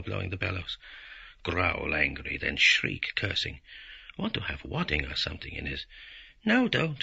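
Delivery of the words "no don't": much higher, louder protest; cried out. Acted. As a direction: cry out to stop him. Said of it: "she cried".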